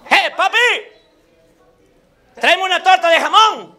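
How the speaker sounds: high, forceful call to the waiter; calling out an order. Acted. Another diner orders from the waiter, high calling out. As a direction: holler the order across the room.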